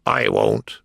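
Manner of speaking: very low, blunt refusal; stubborn cook. A narrator's reading. Cook refusing the King in court, low and stubborn. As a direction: dig in with a deep voice.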